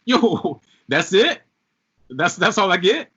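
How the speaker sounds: high, loud incredulous questioning; mock disbelief. Voicing a listener when the song just ends, incredulous. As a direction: let down and baffled, pitch climbing. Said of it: "and you're like".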